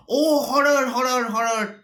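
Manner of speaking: high, loud cry of horror; horrified cry. Acted. Macduff crying out to the household, horrified. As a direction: cry out as if seeing the murdered king. Macduff discovers the murdered king.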